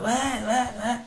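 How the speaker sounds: high-pitched mock junkie answer; junkie imitation. Junkie answering angry car owner, high uncomprehending what. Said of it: "he was just a pure junkie".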